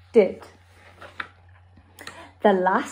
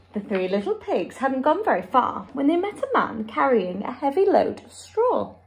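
ordinary delivery, nothing marked

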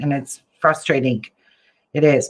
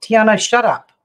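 louder snap at someone off-camera; calling out; 5 semitones higher, 6 dB louder than usual. Real, not acted. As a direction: raise your voice to shush her.

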